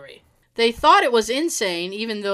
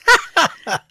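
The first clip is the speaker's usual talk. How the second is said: laughing; 7 semitones higher, 9 dB louder than usual. Real, not acted. Laugh while reading story.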